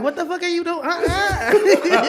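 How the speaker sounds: high, loud disbelieving yell; hammed-up shocked yell; mock outrage. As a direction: shout it like a stunned customer. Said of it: "They gonna be like".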